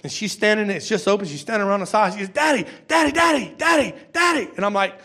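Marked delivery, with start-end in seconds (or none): 2.3-4.5 put-on high, insistent little-girl calls of Daddy; child calling daddy. Pastor imitating his daughter calling him, high and insistent. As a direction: keep calling for his attention like an eager small child.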